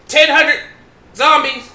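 loud and high, in short bursts; exasperated shouting. Real, not acted. Exasperated at zombie horde.

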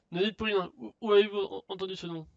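alarmed. Acted. Merchant hears his secret lover mentioned, alarmed. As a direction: cut them off, a touch panicked.

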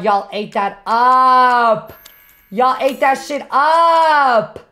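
drawn-out hype cheer on "up"; hyped praise. Real, not acted. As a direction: gush it, stretching the last word long. Cheering on gifters in stream.

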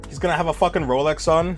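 ordinary talk

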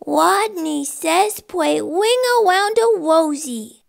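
high little rodent voice with w for r; small, lisping rodent voice; squeaky rodent. Acted. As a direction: squeak out a playground command. Timid rodent's squeaky command.

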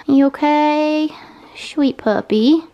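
slow, tender comfort to the dog; worried parent. Real, not acted. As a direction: soothe the hurt puppy gently.

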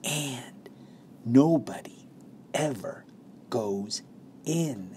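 soft, drawn-out words with pauses; secret hush. A narrator's reading. Grandfather continuing his secret to Charlie, hushed and spaced out. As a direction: let each word fall softly, like a secret. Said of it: "lowered his voice to a soft, secret whisper".

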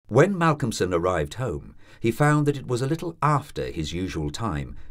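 plain reading voice

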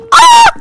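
sharp high startled yelp; a real jump-scare squeal. Real, not acted. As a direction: yelp in sudden fright. Sudden scare in game.

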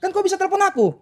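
ordinary talk, nothing marked

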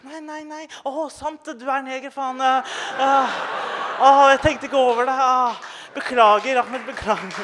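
a hint of a flustered apology; teacher apologizing voice. The teacher apologizes to the crying boy, high and lightly voiced.

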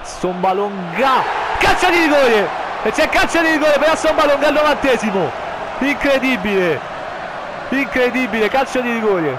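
high, loud penalty shout; breathless italian goal commentary. Acted. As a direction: shout the penalty call in disbelief.

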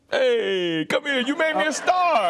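loud, very high joyful call; elated greeting. Retold or imitated. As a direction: holler it across the room, thrilled to see him. Acts out Bunk's delighted greeting across room.

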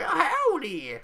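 high, loud prospector howdy; old prospector. Pretend. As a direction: yell it like a grizzled prospector. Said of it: "he would have an old prospector voice".

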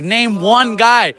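imitating a drawn-out challenge; retold challenge. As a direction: draw out each word of the dare. Retold challenge in trash talk.

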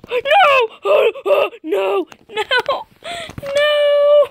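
high, loud gasping cries of no; panicked shouting. Pretend. Player caught by Spider in a game, panicked shouting. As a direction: cry out in alarm between gasps, pitch leaping up.

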